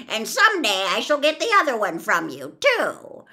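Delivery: loud wicked witch taunt; big, menacing witch voice; threatening witch. A narrator's reading.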